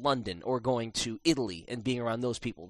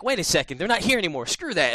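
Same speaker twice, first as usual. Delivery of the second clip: higher, louder shrug-off; defiant again; 7 semitones higher, 6 dB louder than usual. Retold or imitated. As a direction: snap out of the spell and defy. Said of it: "I'm like".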